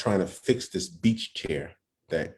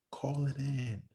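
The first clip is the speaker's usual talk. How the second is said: near-silent mouthing, much softer; mouthed words; 5 semitones higher, 8 dB quieter, much slower than usual. Retold or imitated. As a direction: barely voice it, just shaping the words. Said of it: "mouthing in the words".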